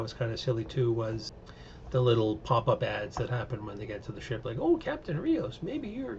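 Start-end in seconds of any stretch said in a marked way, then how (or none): 4.6-6.2 high, syrupy advertising pitch; mock ad voice. A pop-up ad pitches to Captain Rios, high and sugary. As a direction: sell it like a cheesy pop-up ad.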